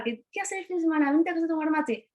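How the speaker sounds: eager invitation. Retold or imitated. New friend inviting her over for mate, eager quick.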